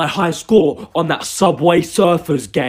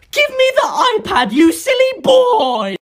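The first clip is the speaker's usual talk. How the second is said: high grandma voice. Acted. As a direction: squeal it like a bossy granny. Grandmother demands the iPad.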